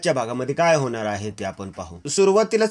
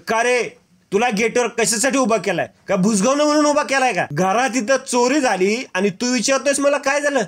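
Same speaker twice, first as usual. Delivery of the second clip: furious scolding; 8 semitones higher than usual. Acted. Mahipat berating the gate guard after the theft, furious. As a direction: tear into him for failing his job.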